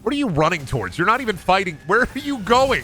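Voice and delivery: incredulous calling after a fleeing opponent; heated taunt. Real, not acted. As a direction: climb in pitch with each question.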